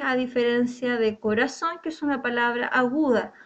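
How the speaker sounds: ordinary talk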